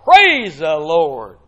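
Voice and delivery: huge, drawn-out shout of praise; exultant exclaiming. Acted. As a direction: roar it like a rejoicing crowd. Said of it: "a great crowd in heaven exclaiming".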